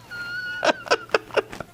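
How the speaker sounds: laughing. Real, not acted. Laughs at the memory.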